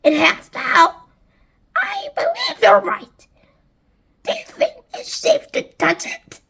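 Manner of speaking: high, squawky character voice; high-pitched wary exclamation; excited aunt. A narrator's reading. Aunts confer over the peach.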